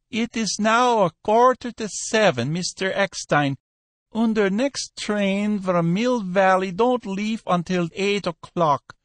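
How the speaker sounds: high dealer voice, unhurried; Cohen the dealer. Acted. Cohen's long phone reply.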